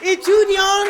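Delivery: loud, high fired-up preaching; preaching at full force. Acted. Preacher at full force about persecution.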